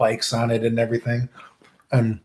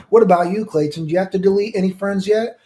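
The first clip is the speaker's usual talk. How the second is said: put-on higher, needling kid voice; mocking a bragging classmate; taunting Church Boy; 7 semitones higher than usual. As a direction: poke at him like a smug rival. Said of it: "He's like".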